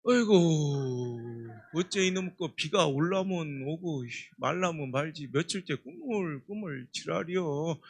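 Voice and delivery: put-on low, slow grumbling old man in Chungcheong dialect; old Chungcheong farmer. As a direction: grumble about the weather like a country grandpa. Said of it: "할아버지가".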